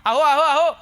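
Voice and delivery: loud wheedling "अहो, अहो, अहो"; coaxing wife. Pretend. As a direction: nag the husband for a sari. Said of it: "नवऱ्याला हट्ट करावा".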